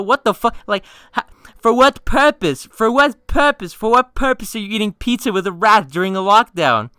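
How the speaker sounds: very high, full-voiced comic outrage; incredulous screaming rant. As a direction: scream in disbelief at your friend. Escalated acted rant at friend's nonchalance.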